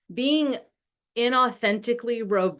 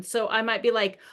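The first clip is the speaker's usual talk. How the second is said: usual speaking voice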